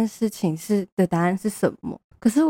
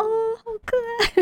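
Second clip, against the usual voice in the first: gushing squeal; much slower than usual. Real, not acted. Gushing over cute stationery.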